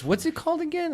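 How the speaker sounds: very high imitated clerk voice; high-pitched, unsuspecting shop assistant; saleswoman. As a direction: imitate a saleswoman asking innocently. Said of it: "And she goes".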